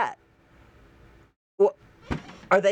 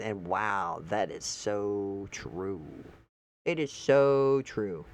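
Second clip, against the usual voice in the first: much lower, softer, slow that is so true; quiet agreement; 11 semitones lower, 6 dB quieter, slower than usual. Real, not acted. Agreeing with the quoted post, low and soft. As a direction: murmur it slowly, impressed. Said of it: "quiet slow agreement".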